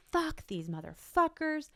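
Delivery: high-pitched mock scream of rage; half-volume furious outburst; retold angry yell. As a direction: re-enact raging at the scammers, pitch jumping up. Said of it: "yelling. I am screaming. I am so angry".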